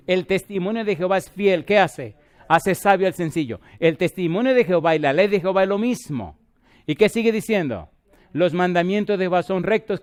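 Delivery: loud preaching of the psalm, call and answer; full-force preaching. Acted. Preacher explaining Psalm nineteen to his congregation, fervent and loud. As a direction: proclaim each verse and answer it yourself.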